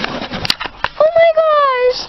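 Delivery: very high, very slow stretched squeal; excited squeal. Real, not acted. Squealing at the big box arriving, drawn out. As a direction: drag it out in a high thrilled squeal.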